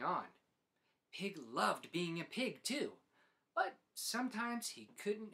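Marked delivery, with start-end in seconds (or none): none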